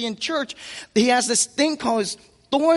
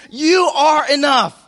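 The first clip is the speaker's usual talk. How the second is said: louder, slow, emphatic call; bullhorn yell; 4 semitones higher, 6 dB louder, slower than usual. Acted. Spirit calling out to the weak through a bullhorn, loud and slow. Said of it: "Yells through the bullhorn and says".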